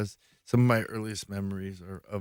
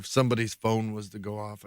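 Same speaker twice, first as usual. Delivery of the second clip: normal talking voice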